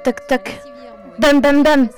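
ordinary talk

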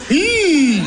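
a polite forced mmm; fake approving hum. Being polite to the in-laws about bad food, fake approving hum. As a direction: pretend you like it. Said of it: "Je zegt".

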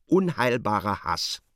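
normal talking voice

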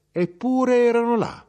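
high, loud insistence; spring the secret on him; mother revealing with a high, loud lift; emphatic reveal. Acted. Mother reveals hiding place.

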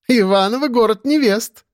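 high, teasing woman's voice; playful high-voiced quip; amused woman colleague. A narrator's reading. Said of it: "засмеялась курчавая соседка".

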